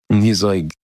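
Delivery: ordinary delivery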